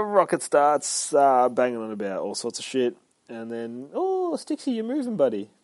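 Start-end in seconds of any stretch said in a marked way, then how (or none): 3.9-5.5 talking to a pet. Real, not acted. Host breaks off for Stixie, high cooing. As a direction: coo in a high little pet voice.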